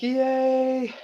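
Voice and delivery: put-on high little-kid yay; baby cheer. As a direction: squeal it like a toddler. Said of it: "She's like".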